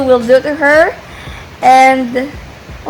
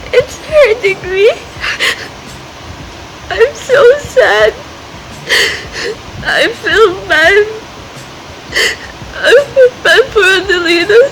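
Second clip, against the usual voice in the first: high, teary voice, sobbing through the words; real crying; 7 semitones higher than usual. Real, not acted. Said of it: "I'm crying again".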